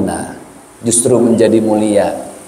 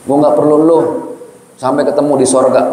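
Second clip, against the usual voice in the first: rude Betawi brush-off; 3 semitones higher than usual. Retold or imitated. Old friend snaps at the apologizer, brusque and cold. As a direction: tell the visitor off in a slangy Jakarta voice. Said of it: "dia bilang apa?".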